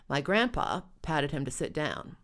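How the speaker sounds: ordinary delivery, nothing marked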